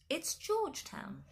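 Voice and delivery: put-on high, slow little-boy voice; supply the answer as a small child; very young child. A narrator's reading. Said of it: "a very young but already familiar voice".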